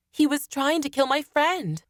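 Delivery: high-pitched, urgent self-justification; justifying. A narrator's reading. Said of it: "I tried to justify".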